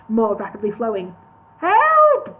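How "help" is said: high, loud drowning yell; gurgled cry for help. A narrator's reading. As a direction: shout for help as water fills the mouth. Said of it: "gurgled Tori".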